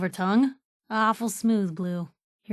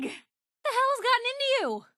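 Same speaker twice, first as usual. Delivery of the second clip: startled anger; 13 semitones higher than usual. Acted. Partner attacks her.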